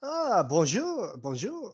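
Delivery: slow, high-starting French greeting; bartender greeting. Acted. Bartender answers a customer at the bar, slow greeting. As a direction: greet a customer in a drawn-out lilt. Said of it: "wander up to the bartender".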